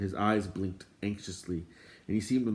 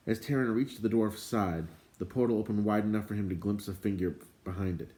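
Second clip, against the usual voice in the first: plain reading voice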